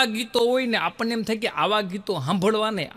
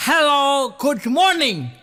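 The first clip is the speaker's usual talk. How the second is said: foreigner's greeting; 6 semitones higher, 4 dB louder than usual. Retold or imitated. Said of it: "ભૂરીઓ એમ જ બોલે".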